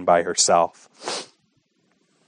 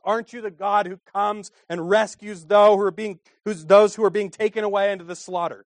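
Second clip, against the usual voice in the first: much higher, anguished plea to God; pleading prayer; 10 semitones higher than usual. Acted. Preacher crying out to God for the babies. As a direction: cry out to heaven for the weak.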